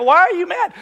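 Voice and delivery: high, loud demanding question; retold heated challenge; exasperated dad. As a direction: imitate a father arguing with his kid. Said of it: "my dad would get mad at me".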